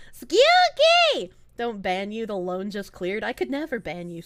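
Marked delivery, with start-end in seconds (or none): 0.1-1.3 high, loud call of the name; excited shout of the name. Real, not acted. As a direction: call out to them across the room. Calling out to chatter.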